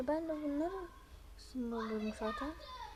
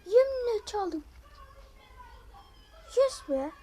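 loud, high triumphant yelling; real thrill of stealing a kill; excited shout; 11 semitones higher, 9 dB louder than usual. Real, not acted. Gamer yells to viewers after stealing a kill, excited shout.

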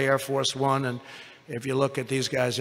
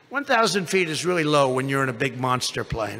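ordinary delivery

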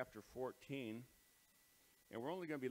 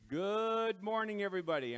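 loud greeting; 9 semitones higher, 11 dB louder than usual. Real, not acted. Opening greeting to a hall.

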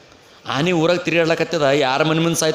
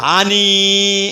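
drawn-out hesitant word; 4 dB louder, much slower than usual. Acted. Man hesitantly reports the elephant.

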